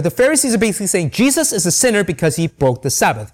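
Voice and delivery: normal talking voice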